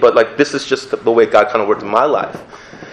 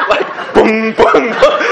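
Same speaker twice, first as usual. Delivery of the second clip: mock slapping noises; 11 semitones higher, much slower than usual. Imitating slaps during prayer.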